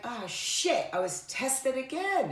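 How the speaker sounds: high, rueful exclamation of catching yourself; rueful oh-shit realization. Retold or imitated. Telling listeners about getting caught in anger, a high rueful exclamation. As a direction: realize you've failed the test again. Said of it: "and you're like".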